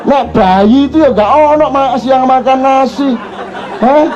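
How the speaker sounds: put-on high indignant child's protest; child's outrage. Son complaining about silly song lyrics, high and indignant. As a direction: voice the kid scolding, pitched high.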